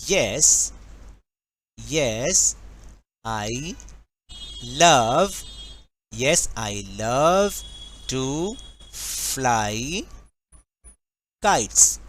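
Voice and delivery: word by word, long pauses between; slow dictation. Shown as a demonstration. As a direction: draw out each word for someone writing it down. Dictating the answer as he writes.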